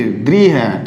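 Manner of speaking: द्री with a clear long "ee"; dri sound. Shown as a demonstration. Teacher points out the correct syllable, dri. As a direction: say द्री low, ending in ee. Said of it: "क्योंकि ये री".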